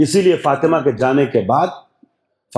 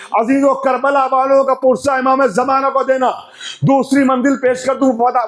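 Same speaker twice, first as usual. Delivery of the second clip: impassioned majlis preaching; 10 semitones higher than usual. Acted. Preacher urging the mourners to cry for Zainab, impassioned. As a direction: rouse the hall to weep aloud.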